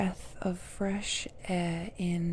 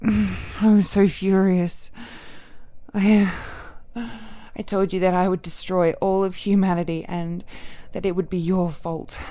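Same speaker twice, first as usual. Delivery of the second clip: rising fury; 7 dB louder than usual. Acted. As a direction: let the old rage break through. Recalls furious threat in anger.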